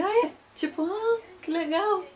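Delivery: high, slow, absent-minded voice; dazed reply. Retold or imitated. Exhausted mother answers her husband, dazed and slow. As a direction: sound far away and vague. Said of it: "Eu tava assim".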